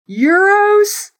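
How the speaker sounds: a commentator who can't quite believe the word; incredulous echo. Retold or imitated. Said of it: "he was like".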